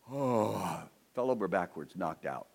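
ordinary talk

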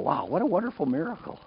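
high, swooping wonder; acted-out happy amazement; rejoicing. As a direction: marvel at it with delight. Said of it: "I'm rejoicing with it".

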